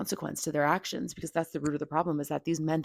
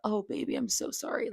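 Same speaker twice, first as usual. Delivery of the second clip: higher, syrupy fake-sorry voice; mock sweet apology; 6 semitones higher than usual. Imitating the cheating boyfriend begging girlfriend's forgiveness, mock sweet. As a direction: parody his pleading apology.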